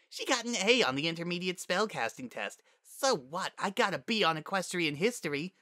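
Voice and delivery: high-pitched sarcastic griping; resentful mockery. A narrator's reading. Overlooked daughter stews over praise for her sister, mockingly. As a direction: mimic the proud parents, then gripe. Spitfire mocks parents' bragging, resentful.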